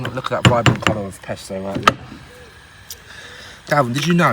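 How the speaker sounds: ordinary talk, nothing marked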